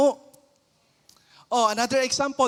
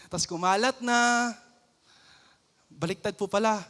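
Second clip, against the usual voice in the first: ordinary talk, nothing marked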